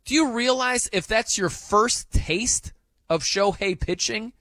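ordinary delivery

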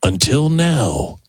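slow, dramatic trailer announcer; drawn-out, portentous movie promo read. Acted. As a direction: stretch it out like a film teaser. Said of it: "That's the trailer voice".

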